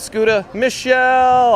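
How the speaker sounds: high, drawn-out "Michelle"; excited, stretched-out name shout; excited call-out. Acted. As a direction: sing out the name slowly and high. Calls out viewer's name from chat.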